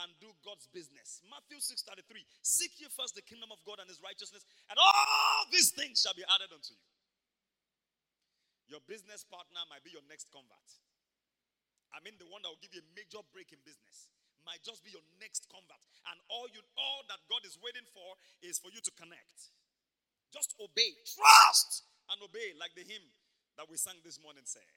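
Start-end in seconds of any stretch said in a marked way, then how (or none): 2.5-6.9 preaching at full force. Real, not acted. Quoting scripture, building to shout. 20.3-22.6 high then loud and slow, drawn-out shout; preaching at full force. Real, not acted. Preacher urging the congregation to obey, in a loud slow drawn-out cry.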